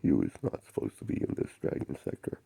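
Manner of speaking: whispered, not shouted; a retold yell done as a whisper; hushed mock shout. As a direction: hiss the warning under your breath. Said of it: "And the Pi shouted".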